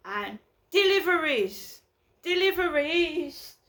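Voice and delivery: courier calling. Retold or imitated. Imitating the delivery man at the buzzer, calling out.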